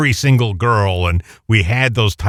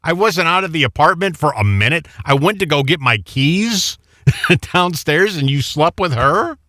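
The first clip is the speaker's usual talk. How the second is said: high-pitched pretend accusation; mock outrage; 6 semitones higher than usual. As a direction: play the betrayed friend, half-joking. Acting out confronting his old friend.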